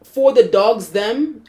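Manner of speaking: put-on slow, drawn-out "For the Dogs Them" in a light Toronto accent, "Them" like "dem"; unhurried, lightly accented [name]-style "For the Dogs Them"; [name]'s accent. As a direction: stretch it out with [name]'s Toronto lilt. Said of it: "you're gonna hear an accent".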